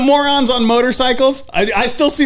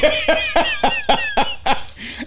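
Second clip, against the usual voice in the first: squealing laugh, pitched way up; high burst of laughter; 4 dB quieter than usual. Real, not acted. Cracking up at the co-host's Monopoly joke, high laugh. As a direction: let out a high helpless laugh.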